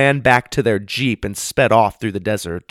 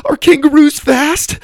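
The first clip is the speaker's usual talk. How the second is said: very high and loud, breathless with fear; panicked question; 9 dB louder than usual. Acted. Susie asking while running for her life, panicked high yell. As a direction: yell it mid-sprint in panic.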